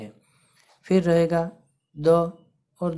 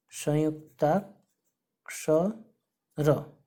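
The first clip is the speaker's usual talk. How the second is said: संयुक्ताक्षर stretched out very slowly, syllable by syllable, softer; slow syllable drill; 4 dB quieter, much slower than usual. Shown as a demonstration. Teacher to class, drawing out the new term slowly. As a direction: spell the word out slowly for the class. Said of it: "teacher repeats term for class".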